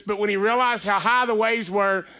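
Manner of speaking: normal talking voice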